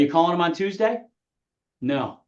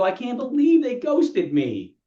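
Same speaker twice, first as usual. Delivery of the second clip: high, drawn-out mock complaint; slow, higher-pitched whine of disbelief; mock indignation; 7 semitones higher, slower than usual. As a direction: imitate an agent in disbelief. Mimics agents complaining of being ghosted.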